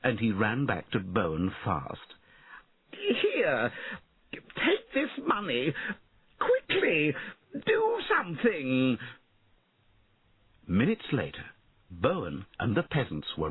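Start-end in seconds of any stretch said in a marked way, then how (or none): 2.9-9.0 high, urgent, drawn-out cries; frantic storybook lord calling for rescue; panicked pleading. A narrator's reading. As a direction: beg desperately at high pitch. Panicked lord begs for help.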